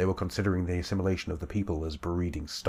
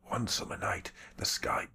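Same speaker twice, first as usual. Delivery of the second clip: put-on weak whispered old man voice; breathless rasping whisper, barely alive; frail old man whispering; 3 semitones higher, 7 dB quieter than usual. Old shaman tells his story.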